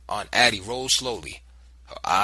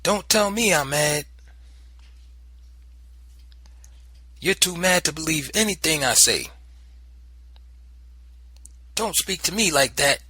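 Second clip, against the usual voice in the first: raised, stern and cold; angry retort; 7 semitones higher, 4 dB louder than usual. Acted. Aunt scolding the boy, raised and stern. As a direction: snap back in anger.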